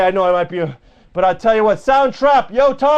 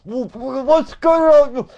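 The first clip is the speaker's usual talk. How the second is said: slow, slurred mock voice; Barney Franks; much slower than usual. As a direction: drag the words out in a mumbling parody. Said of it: "Barney Franks".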